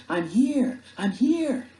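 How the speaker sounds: high, loud call across water; urgent yell. Acted. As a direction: yell so rescuers find you. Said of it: "I yelled".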